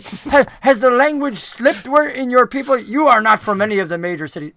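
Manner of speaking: high, loud, affronted goblin; indignant dark goblin. Acted. Dark goblin scolding a stranger who botched his name, indignant and loud.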